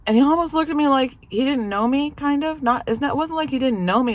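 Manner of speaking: telling it high and lively, amazed; excited. Real, not acted. Wife telling viewers, amazed and high-pitched. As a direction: voice jumps up in disbelief. Excited retelling of husband's confusion.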